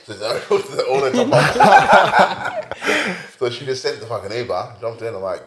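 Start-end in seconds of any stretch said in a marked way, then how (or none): 0.1-3.4 cracking up through the words; slow and higher, dissolving into laughter; laughing. Real, not acted. Joking with mates about the Uber top up, laughing. Joke about topping up card.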